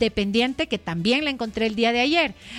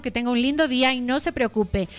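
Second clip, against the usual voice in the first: usual speaking voice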